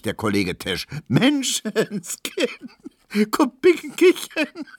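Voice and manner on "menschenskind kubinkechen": very high, drawn-out laughing cry; shrieking with laughter. A narrator's reading. Tesch beside himself over the letter, shrieking laughter. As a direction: squeal the name, helpless with laughter. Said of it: "lachte und schrie".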